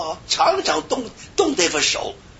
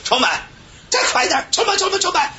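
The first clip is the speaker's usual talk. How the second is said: high, hurried mock chant; urged faster. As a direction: imitate driving a chant ever faster. (模仿高声催促快喊)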